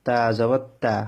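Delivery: "তা জবর তা" with a thin, light তা; light letter demo. Shown as a demonstration. Teacher spelling "তা জবর তা" for students, light তা. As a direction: spell "তা জবর তা" lightly, tongue tip at the upper teeth. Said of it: "যেমন".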